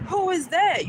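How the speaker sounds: high puzzled question; puzzled who. Retold or imitated. Spotting a figure at dusk, asking who's there. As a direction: ask it high, squinting into the dusk. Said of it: "And I'm like".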